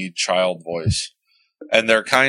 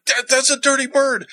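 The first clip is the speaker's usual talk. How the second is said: high-pitched retold freak-out at a discovery; astonished reaction. Collector retelling spotting a rare toy in a picture, high-pitched astonishment. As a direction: act out spotting something unbelievable, voice shooting up. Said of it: "I saw the picture. I'm like".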